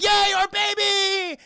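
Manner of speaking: high, loud, drawn-out celebration; hammed-up happy shout; mock cheer. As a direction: squeal it like overjoyed cartoon parents. T-Rexes cheering baby's return.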